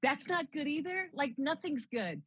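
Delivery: high, dismayed protest; retold dismay. Reporter reacting to her boss's criticism, dismayed. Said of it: "I'm like".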